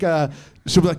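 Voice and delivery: ordinary talk, nothing marked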